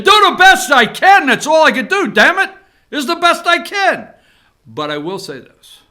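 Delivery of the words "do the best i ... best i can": high, loud do the best I can; loud frustrated damn it rant; exasperated outburst. Real, not acted. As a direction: vent your frustration.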